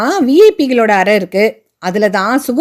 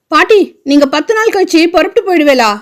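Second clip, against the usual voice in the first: anxious question; 6 semitones higher than usual. A narrator's reading. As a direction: blurt it out, worried she'll leave. Girl anxiously asks grandmother in car.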